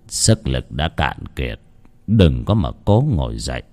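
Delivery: put-on calm stern old healer; old man voice. Mysterious man speaks to injured. (giọng ông thầy trầm thấp, chậm)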